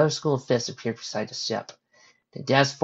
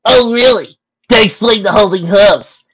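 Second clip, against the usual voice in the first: loud, high, drawn-out challenge; teasing challenge; 10 semitones higher, 14 dB louder, slower than usual. Acted. Rainbow doubting her friends' denial, loud teasing challenge. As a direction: press them loudly, disbelieving.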